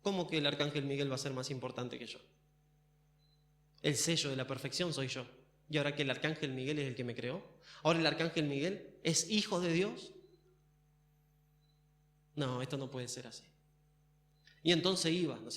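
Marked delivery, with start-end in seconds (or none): 0.0-10.1 low, brooding indignant questions; quietly wounded pride, pitched low; envious Lucifer. Acted. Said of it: "Nació envidia". 12.3-13.4 low, hushed disbelief; soft, brooding refusal to accept it; envious Lucifer. Acted. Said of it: "Nació envidia".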